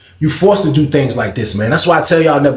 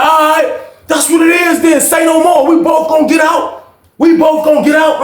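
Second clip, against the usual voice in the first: defiant shout; 12 semitones higher than usual. Retold or imitated. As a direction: accept the fight and shout it. Jerome accepting the fight, shouting.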